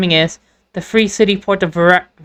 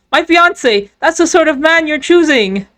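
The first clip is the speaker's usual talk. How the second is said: miserable shout; 6 semitones higher, slower than usual. Acted. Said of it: "he shouts out all miserable".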